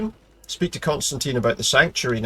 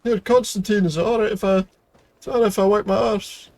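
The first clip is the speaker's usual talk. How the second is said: playful character voice; 8 semitones higher than usual. Pretend. Talking to Constantine as his character.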